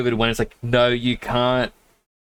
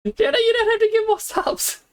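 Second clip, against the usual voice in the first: much higher, amazed protest; surprised protest. Real, not acted. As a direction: squeal it in disbelief. Second gift surprises streamer.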